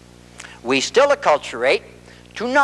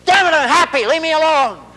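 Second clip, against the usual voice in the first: much higher, louder furious snap; angry outburst; 13 semitones higher, 5 dB louder than usual. Acted. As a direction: explode at her to make her stop asking. Husband blows up at wife.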